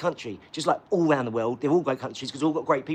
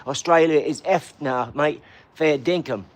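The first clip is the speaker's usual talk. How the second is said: loud, slow, exaggerated Australian accent; mock Australian accent; 3 semitones lower, 5 dB louder, slower than usual. Joking to guys in Australia, hammed-up Australian accent. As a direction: ham up a broad Australian accent. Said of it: "that accent is outrageously big".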